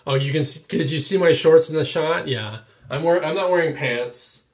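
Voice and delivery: loud, laughing reveal; excited. Real, not acted. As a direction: blurt it out, thrilled and loud. Revealing shorts on camera.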